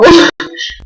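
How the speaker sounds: short high laugh at himself. Real, not acted. Laughing at his own bad garfish description, real short laugh. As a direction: burst out laughing at the joke.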